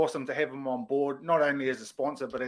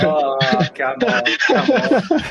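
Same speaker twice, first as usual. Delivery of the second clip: loud, laughing "come on"; laughing protest; 11 dB louder, slower than usual. Real, not acted. Mock protest at the joke.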